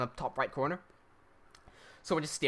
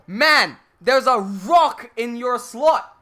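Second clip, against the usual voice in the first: much louder, stretched out slowly; incredulous scolding; 3 semitones higher, 8 dB louder, much slower than usual. Retold or imitated. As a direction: rub his nose in it, loud and slow. Scolding the guy who jammed it.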